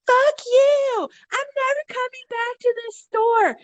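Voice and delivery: hammed-up furious exit yell; imitated shout. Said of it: "she's like".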